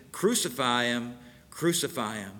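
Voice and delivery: ordinary delivery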